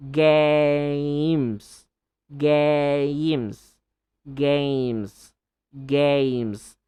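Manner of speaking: "Games" said loud with a long A; teacher drill of "Games". Shown as a demonstration. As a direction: repeat "Games" loud, stressing the vowel. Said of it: "and then faster".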